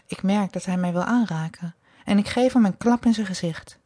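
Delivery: usual speaking voice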